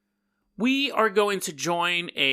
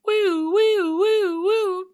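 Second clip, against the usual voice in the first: high-pitched wailing siren sound; siren imitation; 4 dB louder, much slower than usual. Imitating fire truck siren on the way to the fire. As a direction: woo like a fire engine siren. Firefighters rushing to scene.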